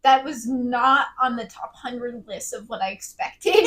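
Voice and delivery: normal talking voice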